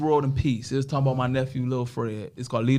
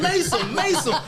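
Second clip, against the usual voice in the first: high-pitched pleading holler of a name; imitated calling out; 13 semitones higher than usual. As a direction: mimic people calling after someone. People calling his name, retold.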